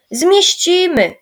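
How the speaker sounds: put-on confident boy insisting, loud and slow; reassuring. A narrator's reading. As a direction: assure them firmly, drawn out. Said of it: "zapewnił Michał".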